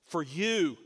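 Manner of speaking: "you" hit firmly, falling at the end; stress on you. Shown as a demonstration. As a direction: lean on "you" as a personal claim. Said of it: "is very emphatic".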